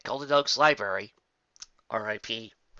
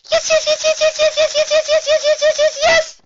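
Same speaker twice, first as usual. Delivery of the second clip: high, loud, giddy repeated yes; excited shouting; 14 dB louder than usual. Acted. Twilight squealing with joy over her new book. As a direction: shriek it over and over with glee. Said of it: "Twilight shouted over and over".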